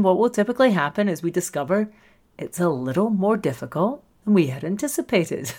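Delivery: ordinary talk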